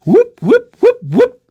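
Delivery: high, loud repeated whoops; whooping noises. Acted. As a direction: make quick popping whoop sounds. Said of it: "he goes like this".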